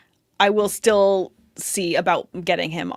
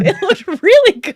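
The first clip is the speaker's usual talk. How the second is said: laughing agreement; 12 semitones higher, 9 dB louder than usual. Real, not acted.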